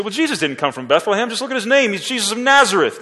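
high, fast, mocking voice of the scoffers; scoffing doubters. Preacher mocks the scoffers, fast and high-pitched. Voicing scoffers citing Bethlehem.

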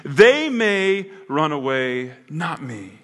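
loud, high, emphatic avowals, dropping at the end; boastful Peter. Acted. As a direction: proclaim supreme loyalty in front of everyone. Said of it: "you told me in front of them".

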